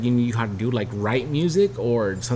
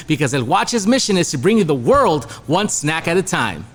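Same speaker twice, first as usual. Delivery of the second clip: upbeat, higher sponsor-read voice; 6 semitones higher, 3 dB louder than usual. Acted. Podcast host reading a smoke shop sponsor ad, upbeat and higher. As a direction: sell it like a radio spot, pitched up.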